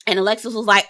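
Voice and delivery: ordinary talk, nothing marked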